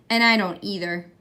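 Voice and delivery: usual speaking voice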